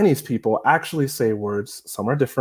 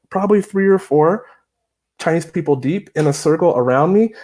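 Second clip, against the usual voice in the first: ordinary talk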